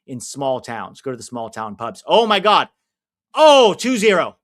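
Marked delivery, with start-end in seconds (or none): 2.1-4.4 loud, high yell at a goal; excited shout. Real, not acted. Fan shouting as France make it two nil, excited. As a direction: cry out as the goal goes in.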